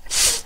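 soft voiceless "th", tongue between the teeth; isolated th sound. Shown as a demonstration. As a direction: hiss "th" lightly through tongue and teeth. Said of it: "我们注意最后一个音标读作". (轻咬舌尖，单独发清辅音th)

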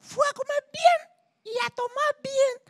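very high, loud squawky Cuban hen. Retold or imitated. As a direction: shriek the punchline like a sassy hen. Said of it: "Dice la gallina cubana".

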